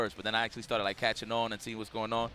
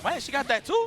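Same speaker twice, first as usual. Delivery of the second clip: high, shocked disbelief; surprised; 11 semitones higher, 5 dB louder than usual. Retold or imitated. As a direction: squeak out the disbelief. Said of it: "I was like".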